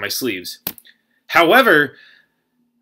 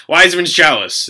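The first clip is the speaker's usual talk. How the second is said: raised, incredulous mock yell; outraged disbelief; 6 semitones higher, 6 dB louder than usual. Player protesting to judges, mock-outraged cry. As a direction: finish the outburst louder and higher. Finishes acted outburst after laugh.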